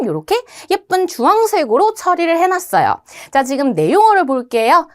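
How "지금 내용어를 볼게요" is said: normal talking voice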